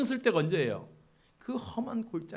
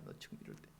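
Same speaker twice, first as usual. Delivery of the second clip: hushed, voiceless repeat of the rebuke; a whispered echo of the prophet's warning; 19 dB quieter, much faster than usual. Acted. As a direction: breathe it back softly for effect. Echoing Nathan's rebuke as whisper.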